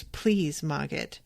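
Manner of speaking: a lightly hushed please, not a true whisper; quiet pleading to the fire spirit; soft plea. A narrator's reading. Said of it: "she just whispers".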